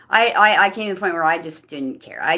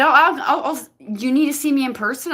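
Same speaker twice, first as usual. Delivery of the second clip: flustered friend; 8 semitones higher, 4 dB louder than usual. Retold or imitated. Friend offers to come over.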